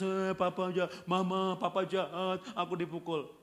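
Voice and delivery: whiny imitation of a crying child; mock crying child. Child complaining to mother about papa, mock crying. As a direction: wail it out like a tattling child, lightly hammed up. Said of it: "Anaknya nangis".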